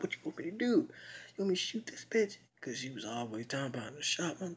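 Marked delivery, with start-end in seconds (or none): 0.0-2.4 high, hushed, disbelieving question; mock incredulous friend. Friend asked to shoot someone, high hushed disbelieving question. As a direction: replay her high and thrown. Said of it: "It's like".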